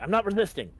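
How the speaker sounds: high, loud protest; insistent, pitch jumping up; alarmed protest. Acted. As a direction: cry out while being grabbed. Troopers grab him by the arms.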